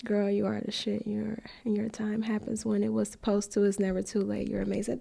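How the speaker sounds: reading kind comments while crying; teary whisper. Real, not acted. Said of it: "I'm tearing up".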